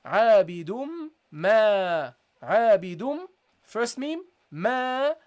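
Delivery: عابد then ما, each loud and separate; split demo. Shown as a demonstration. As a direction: sound the two memes apart. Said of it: "pronounce it with a fatha".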